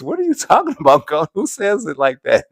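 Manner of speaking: high, loud playful indignation; loud mock outrage. Real, not acted. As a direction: call out the chat, laughing. Mock outrage at a chat comment.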